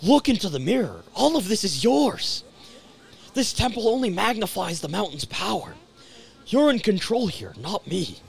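acting out character lines; earnest video game character; dramatic game dialogue.

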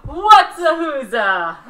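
very slow, loud question; slow drawn-out. Pretend. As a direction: stretch every word out. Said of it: "catch one of our sounds and throw".

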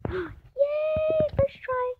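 high gasping squeal of joy; excited yay. Real, not acted. Player tames a puppy first try, high joyful squeal. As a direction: celebrate the first-try tame.

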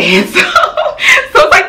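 high, slow laugh through the words. Real, not acted. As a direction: laugh at yourself mid-sentence. Laughs at her own breathlessness.

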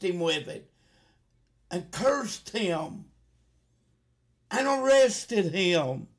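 loud, high, accusing sermon climax; fiery preaching. Acted. Preacher accusing the congregation, rising to a loud fiery shout.

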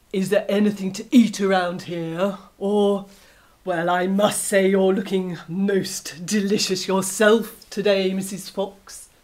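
loud, leering wolf voice; a big wolf eyeing his next meal; greedy wolf. Acted. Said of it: "he said".

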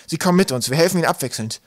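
decisive reassurance; firm. A narrator's reading. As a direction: insist with youthful resolve. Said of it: "erwiderte Twig bestimmt".

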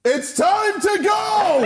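high, loud, slow comic shout. Real, not acted. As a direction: bellow a mock escape. Jokingly flees the interview.

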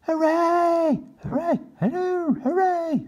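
high cheering cries. Acted. The turkeys cheering their win. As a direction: celebrate out loud. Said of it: "said the turkeys".

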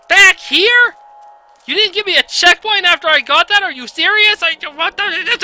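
high, loud outraged shouting; furious disbelief. Real, not acted. Gamer yelling at the developers over the checkpoint, furious and high. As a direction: howl at the game in disbelief.

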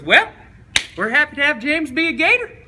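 loud, high-pitched coach voice; hammed-up coach, pitched high and loud; coach impression. Coach winning over mom in the living room, high loud imitation. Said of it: "and goes".

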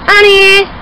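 high, loud call of the name; calling the dog. Real, not acted. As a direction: shout for the dog to come.

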